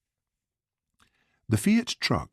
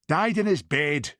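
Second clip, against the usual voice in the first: snapping; 8 semitones higher than usual. A narrator's reading. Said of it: "she snapped".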